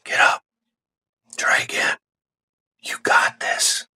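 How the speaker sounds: soft encouraging whisper; quiet whispered encouragement. Acted. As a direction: breathe it like a gentle inner voice. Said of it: "The whisperer is much quieter, softer".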